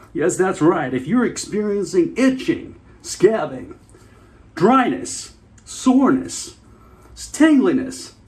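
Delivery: slow, high, loud infomercial voice; pitching a scab cream with mock enthusiasm; hammed-up TV ad. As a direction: sell it like a cheesy late-night commercial.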